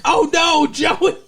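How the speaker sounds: high, loud cry after someone; hammed-up alarmed shout; mock dismayed call. Acts Dawson calling after Joey.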